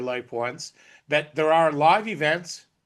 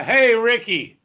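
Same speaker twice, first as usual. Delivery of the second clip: louder call, pitched higher on the name; 8 semitones higher, 7 dB louder, slower than usual. Real, not acted. Host calling Ricky to join the call, loud call. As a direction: call him in across the room.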